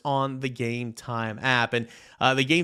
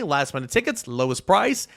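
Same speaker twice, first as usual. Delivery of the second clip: ordinary talk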